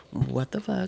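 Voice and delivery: hushed, softly dropped disbelief; mock stunned. Daughter sees mom in pain, mock stunned hush. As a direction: imitate a kid quietly freaked out.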